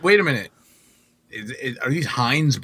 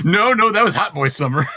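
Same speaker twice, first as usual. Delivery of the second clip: louder, higher, through laughter; laughing; 7 semitones higher, 7 dB louder than usual. Real, not acted. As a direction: protest while laughing. Laughing protest.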